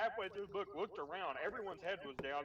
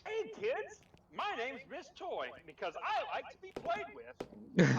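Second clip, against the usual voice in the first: high, slow, mock teacher introduction; substitute introducing herself; 5 semitones higher, 4 dB louder, slower than usual. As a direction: lift it high and draw it out, imitating the sub. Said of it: "in front of the class and she goes".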